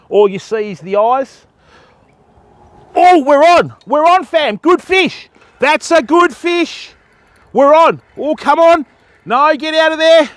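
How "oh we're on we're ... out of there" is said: higher and louder, fish on; real thrill of a fish on the line; excited. Real, not acted. Angler hooks a good fish, excited and loud.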